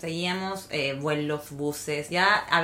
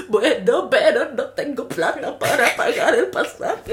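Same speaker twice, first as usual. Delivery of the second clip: higher, louder, slow laughing complaint; laughing mock whine; 5 semitones higher, 8 dB louder, slower than usual. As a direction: whine about having no money. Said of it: "Y yo".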